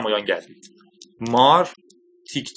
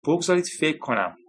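higher-pitched, mildly unsure; hesitant; 4 semitones higher than usual. A narrator's reading. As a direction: say it with a touch of doubt. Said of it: "فالکن با تردید گفت". (با کمی تردید)

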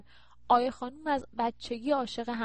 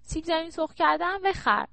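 mild shout, pitched up and a bit louder; calling out; 7 semitones higher, 5 dB louder than usual. Acted. Daughter calls after the departing Naim to buy fries, mild shout. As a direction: call it after someone who is leaving. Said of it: "آیه پشت سر نعیم داد زد".

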